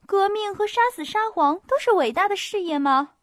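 high, loud incredulous question; appalled challenge. A narrator's reading. Hostess challenging Pierre on regicide, incredulous. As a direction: demand it in disbelief.

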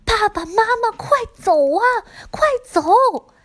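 high, loud frantic cry; desperate calling. Acted. Chihiro calling her parents to flee, frantic high shout. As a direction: scream for them to run. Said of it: "不论千寻怎么叫他们".

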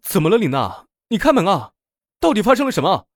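alarmed loud demand for an answer; urgent calling out. A narrator's reading. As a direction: call urgently through a closed door. Man outside urgently calling through door.

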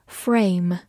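frame said with a clear R, not flame; teacher contrasting R against L. Shown as a demonstration. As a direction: stress the R sound in frame. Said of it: "把中间的字母L变成R".

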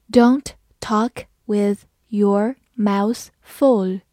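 slow, word by word; slow read-along. Shown as a demonstration. Teacher leading learners through the example, slow and separated. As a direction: leave a gap after every word. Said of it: "好，慢慢来读".

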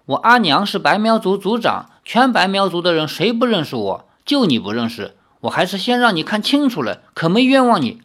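plain reading voice

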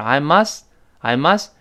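slow "I must" with the final t held back; "I must" ending with no audible t; unreleased t. Shown as a demonstration. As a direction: tip the tongue for the t in "must" without releasing it. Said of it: "t在这儿很明显失去爆破".

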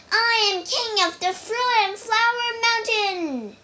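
put-on a child's proud yell, high and loud. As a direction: shout it out to the cave guards. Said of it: "yelled Wukong".

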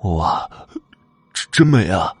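awestruck, stammering admiration; amazed. A narrator's reading. Boy gulping at a beautiful ghost woman, amazed. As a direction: marvel haltingly, tripping on the first word. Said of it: "惊讶地说道".

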